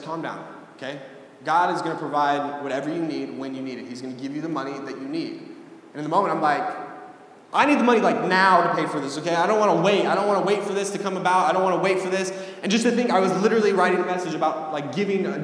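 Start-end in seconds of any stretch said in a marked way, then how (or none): 0.0-5.3 soft, low-key reassurance; reassuring mom. Retold or imitated. Mom reassuring her worried child about the bills, softly calming. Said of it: "she told me to calm down". 7.5-12.3 retold frustrated outburst, pushed a little; impatient protest. Child answering mom's calming words about the bills, impatiently protesting.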